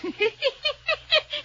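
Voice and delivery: high, gleeful cackle; gloating laugh. Acted. Blackmailer cackling gleefully at the lawyer over letters.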